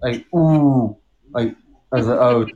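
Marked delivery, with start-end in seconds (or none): 0.4-1.0 "ooh" held long and rounded; overpronounced vowel. Shown as a demonstration. Explaining German letters to friends, overpronounced "ooh". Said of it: "overpronounce the O's".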